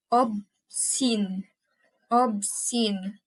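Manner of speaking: "Obscene" split as ob, pause, scene; syllable by syllable. Shown as a demonstration. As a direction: say "Obscene" in two detached syllables. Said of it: "I'm pronouncing it by syllables".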